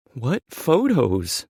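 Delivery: high, loud, slow question; drawn-out high-pitched challenge; defensive denial. Acted. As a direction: act as if you know nothing. Character feigns ignorance in quarrel.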